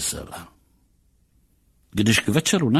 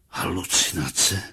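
low, dazed murmur; understated horror, pitched down; horrified mumble; 4 semitones lower than usual. A narrator's reading. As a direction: mumble it as dread sinks in. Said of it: "zamumlal šílený hrůzou".

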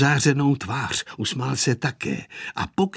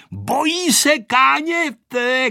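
high, slow, drawn-out yell; excited shout; 10 semitones higher, 4 dB louder, much slower than usual. A narrator's reading. Vojta shouting into the pilot's ear, excited shout. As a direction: call it out high and loud over engine noise. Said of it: "křičel Vojta do ucha".

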